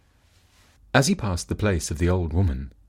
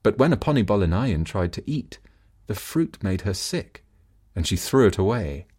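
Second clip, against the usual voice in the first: usual speaking voice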